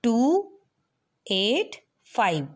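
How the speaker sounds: slow, each digit spaced apart; टू एट five said slowly one digit at a time. Shown as a demonstration. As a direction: read टू, एट, five as separate digits with pauses. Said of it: "इसे हमने separately read करना है".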